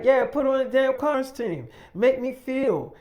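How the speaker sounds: loud, high-pitched retold urging; insistent demand. David urging her into a costume, insistent.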